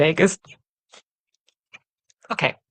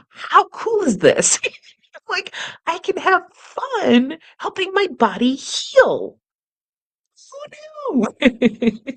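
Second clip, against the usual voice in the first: much higher, slowed, squealing with delight; excited; slower than usual. Real, not acted. Teacher thrilled about exercise, high giddy delight. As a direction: gush with giddy glee, laughing. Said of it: "I'm all excited about this".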